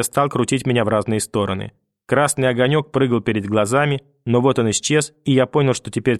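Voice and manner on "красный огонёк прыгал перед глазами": usual speaking voice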